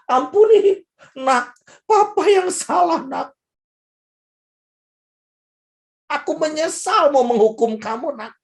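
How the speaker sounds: put-on father to his child, desperate begging; pleading father. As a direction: beg the child's forgiveness, voice cracking high. Father begs child's forgiveness.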